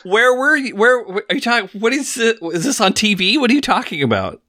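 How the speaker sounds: high-pitched, incredulous questions; baffled questioning. Real, not acted. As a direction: stumble over questions in real bafflement. Baffled co-host quizzing friend about story.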